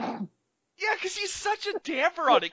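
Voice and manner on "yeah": usual speaking voice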